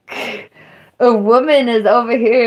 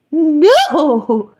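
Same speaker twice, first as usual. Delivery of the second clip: emphatic; 7 semitones higher, 4 dB louder than usual. Real, not acted. Emphatic denial.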